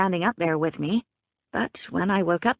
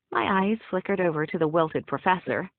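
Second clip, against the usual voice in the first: ordinary delivery, nothing marked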